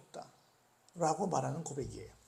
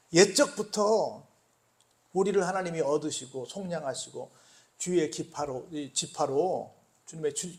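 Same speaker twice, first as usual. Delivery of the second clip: usual speaking voice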